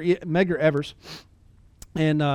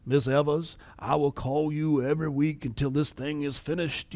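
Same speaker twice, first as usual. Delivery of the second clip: put-on Southern accent; 2 semitones lower, 3 dB quieter than usual. Movie lawyer promising the widow weekly calls, Southern accent. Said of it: "in his horrible Southern accent".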